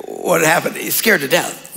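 normal talking voice

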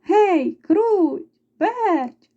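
high, sing-out call of names; rooster hollering. A narrator's reading. Rooster summoning the mice after threshing, calling out. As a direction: call out to someone out of sight. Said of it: "і гукнув".

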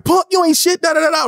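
high-pitched mock tough-guy taunt; retold scrappy insult. Acts out taunt after wrestling.

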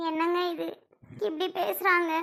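quietly crying to her husband after his sister's insult; tearful. Acted. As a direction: let the words catch as she starts to cry. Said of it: "ஏங்க அழுகுறீங்க?".

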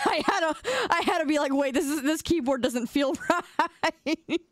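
high, giggly retelling, slowing at the quote; laughing while quoting own confusion. Real, not acted. Streamer laughing as she retells the typing mishap.

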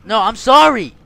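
high, very loud pleading; shouted apology. Real, not acted. Player begging his attacker off, shouted plea. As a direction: cry out the apology urgently.

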